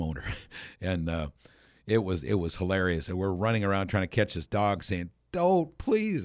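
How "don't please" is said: high-pitched retold plea; mock pleading. Film crew chasing a dog with their prop, mock pleading. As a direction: beg in a much higher voice, imitating desperation.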